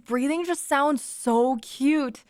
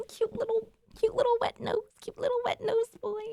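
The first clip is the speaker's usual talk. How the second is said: much higher sing-song cooing; 13 semitones higher than usual. Real, not acted. Cooing about her dog.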